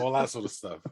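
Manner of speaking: ordinary talk, nothing marked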